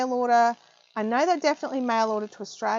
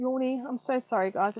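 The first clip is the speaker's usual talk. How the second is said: normal talking voice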